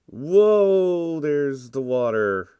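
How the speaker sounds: loud, high startled whoa; surprised. Real, not acted. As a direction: caught off guard, shout it out. Suddenly spots water while driving.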